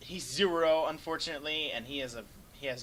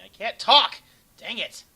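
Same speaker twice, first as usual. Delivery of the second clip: sudden loud, high yelp of frustration; frustrated outburst; 9 semitones higher, 16 dB louder, slower than usual. Real, not acted. Caster tripping over his words, exasperated loud outburst. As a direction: blurt it out, annoyed at himself. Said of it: "What's wrong with my voice?".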